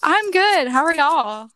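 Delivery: a loud, casual Southern greeting; Southern accent. Real, not acted. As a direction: greet them with a Southern twang. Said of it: "That was so southern".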